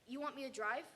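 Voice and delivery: plain reading voice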